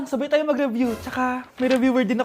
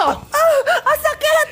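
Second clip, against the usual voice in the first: high yelp of pain; yelling in pain mid catfight; 11 semitones higher, 4 dB louder, slower than usual. Acted. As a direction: cry out as your hair is pulled. Hair-pulling fight, cries in pain.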